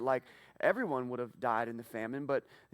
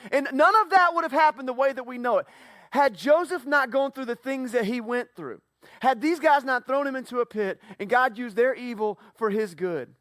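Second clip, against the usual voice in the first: higher, louder impassioned preaching; preaching at full force; 8 semitones higher, 7 dB louder than usual. Real, not acted. Pastor driving home the point to congregation, full-force preaching. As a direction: build to the climax of the sermon.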